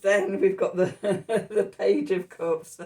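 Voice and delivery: name the card through bubbling laughter; real laughing. Real, not acted. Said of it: "So I'm laughing".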